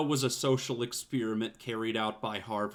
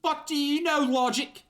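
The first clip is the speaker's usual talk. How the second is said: put-on high, loud, sneering kid voice; Kuno voice; 4 dB louder than usual. Kuno snapping at the detective, high loud sneer. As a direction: throw it back at him, high and loud.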